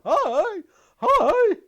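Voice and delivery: very high, loud squeak; squeaky high shout. Pretend. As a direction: squeal it out cartoonishly. Silly squeaky greeting, much louder.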